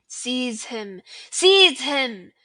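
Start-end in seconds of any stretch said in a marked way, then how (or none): 1.3-2.3 much louder shriek of the command; villain shrieking the order. Acted. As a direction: let the second call tear out. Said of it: "shrieked Voldemort again".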